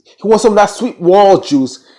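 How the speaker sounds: high, loud, drawn-out relish; gleeful emphasis. Real, not acted. Telling what Jacob wants from Clarissa, gleeful relish. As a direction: savor it slowly and loudly.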